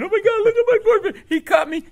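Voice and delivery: high, loud hysterical wail; hammed-up hysterical shriek; crying girlfriend. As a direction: imitate a girlfriend wailing in panic. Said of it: "the girlfriend starts crying".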